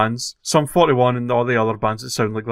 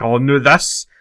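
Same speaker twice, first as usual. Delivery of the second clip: sudden loud groan of frustration; loud exasperated outburst; 3 semitones higher, 9 dB louder, slower than usual. Retold or imitated. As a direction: blurt it out, fed up. Acted annoyed outburst.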